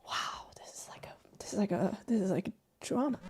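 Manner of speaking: hushed aside, half whispered; whispering. Real, not acted. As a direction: lean in and murmur it to the audience. Aside to viewers during cutscene.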